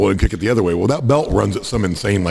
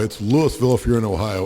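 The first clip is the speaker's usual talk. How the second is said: normal talking voice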